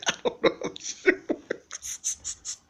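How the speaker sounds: words squeezed out through laughter, slow; breathless laughing quote. Real, not acted.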